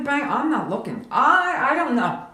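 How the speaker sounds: ordinary delivery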